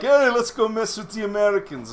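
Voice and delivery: mocking the Russian soldiers as pranksters; goofy Russians. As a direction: ham up the Russians looking for trouble. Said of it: "the Russians were like".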